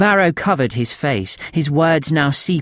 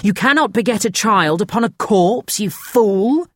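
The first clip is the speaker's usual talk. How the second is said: angry rebuke; 9 semitones higher than usual. A narrator's reading. Narrator scolds Barrow angrily.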